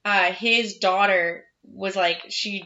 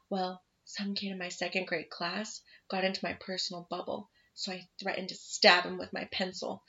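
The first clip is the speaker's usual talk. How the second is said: put-on quiet child's confession; 9 dB quieter than usual. Child's deadpan confession in theater.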